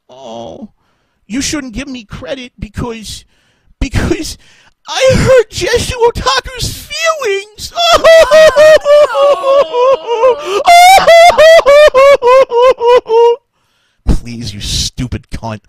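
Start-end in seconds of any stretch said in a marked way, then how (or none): 0.1-7.7 high mocking whine rising to a shout; mock the accusation, building up to a yell. Mocking claim of hurt feelings. 7.8-13.4 loud high cackling laugh; shrieking laugh. Real, not acted. After mocking shout.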